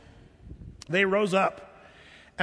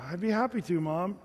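put-on half-hearted kid's compliance, trailing off softer; unconvincing; 3 dB quieter than usual. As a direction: mimic agreeing without meaning it. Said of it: "that's not the way you do it".

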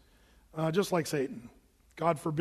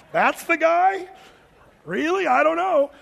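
high, loud, scoffing disbelief; incredulous mockery; 10 semitones higher, 6 dB louder than usual. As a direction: scoff at how small he turned out. Mocking unimpressed onlookers.

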